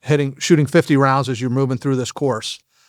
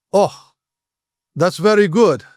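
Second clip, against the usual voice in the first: mock Russian-accented English; 3 semitones higher than usual. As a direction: imitate Russian trainees praising politely in accented English. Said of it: "that's how they talk. That's the Russian accent".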